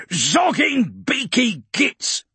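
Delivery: high, slow snarling orc voice; growling orc. A narrator's reading. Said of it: "Grimtooth growled".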